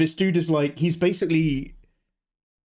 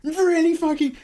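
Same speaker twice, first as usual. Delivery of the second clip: much higher, strained whining rant; high, whiny mock tantrum; indignant rant. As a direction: complain like a wronged child. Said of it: "I was just like".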